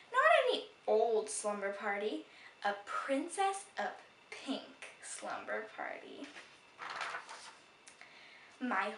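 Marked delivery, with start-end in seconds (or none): none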